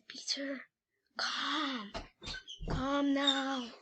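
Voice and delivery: hushed, drawn-out call; mild stage whisper luring a sleeper. A narrator's reading. Someone calling Peter in his sleep, hushed whisper. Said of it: "Someone whispered in Peter's ears".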